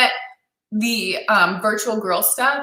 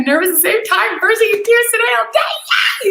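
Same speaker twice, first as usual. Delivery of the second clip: excitedly read; 13 semitones higher, 4 dB louder than usual. Real, not acted. Reading an emotional comment aloud.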